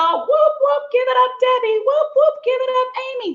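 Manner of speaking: high, loud cheering shout-outs; excited whoops. Real, not acted. Host whooping loudly to greet viewers on her live stream.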